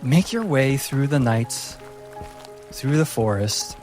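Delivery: normal talking voice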